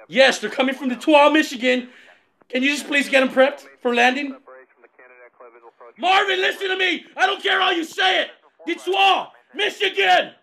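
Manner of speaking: high, loud irritated insistence; exasperated shouting. Acted. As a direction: yell the city name at the controller. Pilot shouting at controller.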